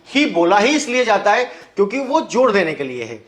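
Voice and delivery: usual speaking voice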